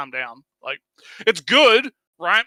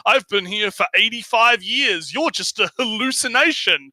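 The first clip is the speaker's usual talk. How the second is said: underwear guy; 5 semitones higher, 5 dB louder than usual. Retold or imitated. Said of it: "who's like".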